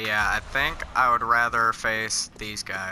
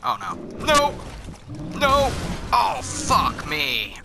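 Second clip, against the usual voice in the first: panicked yelling; 9 semitones higher, much slower than usual. Real, not acted. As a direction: scream in panic as he attacks. Attacked by axe man.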